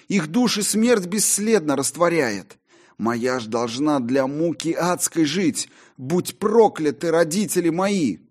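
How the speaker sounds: high, impassioned tragic lament; raving Faustus. Acted. Faustus curses his parents, high and impassioned. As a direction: curse fate in rising despair.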